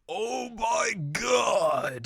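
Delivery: very high, drawn-out shout; overjoyed cry; hammed-up joy. Yu hearing Nanako comes home, very high drawn-out shout.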